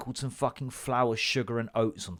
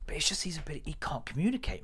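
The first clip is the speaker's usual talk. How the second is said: whispered aside; 2 semitones higher, 7 dB quieter, faster than usual. Acted. Lowered confiding aside.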